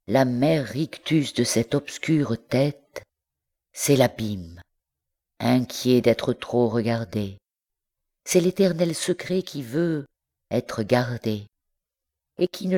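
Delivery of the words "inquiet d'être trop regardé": ordinary delivery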